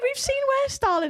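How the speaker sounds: high, breezy paramedic reassurance; mildly mock a cheerful paramedic; lightly voiced paramedic. Paramedic brushing off her apology, lightly voiced.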